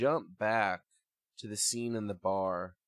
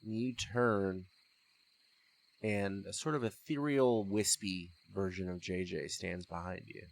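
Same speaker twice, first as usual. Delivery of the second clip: usual speaking voice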